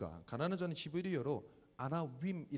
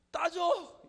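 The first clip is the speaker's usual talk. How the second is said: put-on toddler demanding; 11 dB louder, much slower than usual. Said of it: "이렇게".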